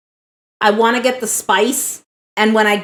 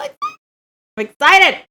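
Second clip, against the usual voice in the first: excited; 9 semitones higher than usual. Real, not acted. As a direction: sound thrilled. About to eat.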